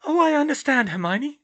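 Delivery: high-pitched, untroubled reassurance; serene voice. A narrator's reading. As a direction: serene girl, high and ringing. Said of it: "in her usual serene voice".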